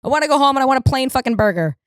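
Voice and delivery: snapping back at the burger lady; exasperated outburst. Retold or imitated. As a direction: blow up at the cashier. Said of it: "I'm like".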